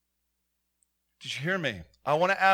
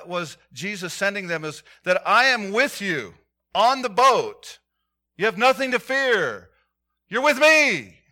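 louder, higher preacher's cry; fervent sermon delivery; full-force preaching; 5 semitones higher, 8 dB louder than usual. Real, not acted. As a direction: proclaim it to the church.

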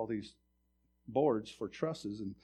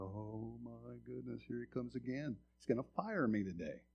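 soft inner groan of resignation; quiet dread; 10 dB quieter than usual. Retold or imitated. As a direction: say it under your breath, dreading the boss. Inner dread as boss approaches.

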